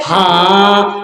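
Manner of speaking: long-held low monotone answer; comically drawn-out obedient reply; simpleton son. Acted. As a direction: stretch the word out dumbly on one note. Dim son answers as told.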